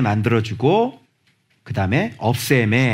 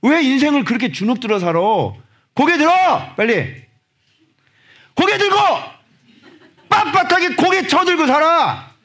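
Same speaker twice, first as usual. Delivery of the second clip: higher and louder commanding call; rousing exhortation; 13 semitones higher, 4 dB louder than usual. Acted. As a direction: order them to lift their heads. Exhorting congregation loudly.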